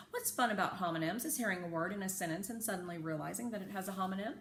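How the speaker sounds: ordinary delivery, nothing marked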